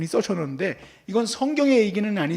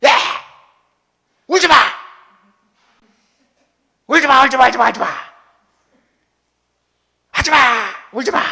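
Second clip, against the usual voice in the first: put-on much louder, harsh repeated yells at a crying child; angry yelling; 5 semitones higher, 10 dB louder, faster than usual. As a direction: scream at the kid to stop crying. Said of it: "소리 질러". (우는 아이에게 소리 지르는 아빠)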